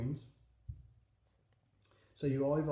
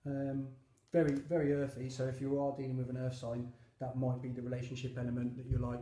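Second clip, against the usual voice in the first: usual speaking voice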